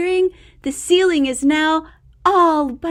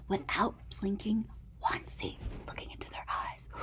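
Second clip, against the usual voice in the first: much softer whisper; 5 semitones lower, 16 dB quieter, faster than usual. A narrator's reading. Reading the staring contest to a child, whispered. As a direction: hold your breath.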